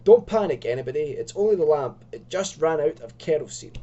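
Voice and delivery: loud call to the room. Acted. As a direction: raise your voice to steady everyone. Calling out in sudden darkness.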